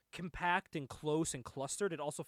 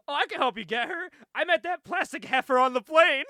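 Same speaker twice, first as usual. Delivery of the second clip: loud, high imitation of an eager girl; eager offer; 10 semitones higher, 12 dB louder than usual. Retelling the girl's offer to help, mock eager. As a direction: pipe up loudly, hammed-up.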